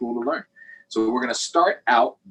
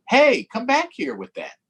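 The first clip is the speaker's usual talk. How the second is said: calling after her; 13 semitones higher, 6 dB louder than usual. Acted. Ramon calls after sister.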